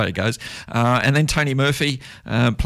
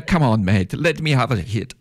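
amateur West Indian accent, put on for a quote; 2 semitones lower than usual. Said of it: "my best South, uh, West Indian accent".

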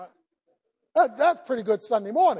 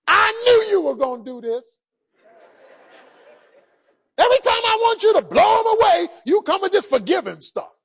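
angry complaint; 5 semitones higher than usual. Acted. Jonah shouting at God over forgiven sinners. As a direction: rail at God for being too kind.

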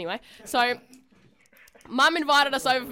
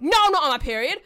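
defensive snap; 4 semitones higher, 6 dB louder, faster than usual. Retold or imitated. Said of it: "I was like".

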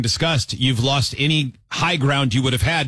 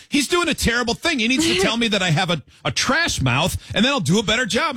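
imitating indignant wife; 9 semitones higher than usual. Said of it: "She goes".

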